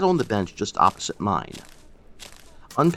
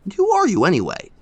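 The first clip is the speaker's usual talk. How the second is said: high and louder, eager question; excited cry; 10 semitones higher, 5 dB louder than usual. A narrator's reading. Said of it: "I cried".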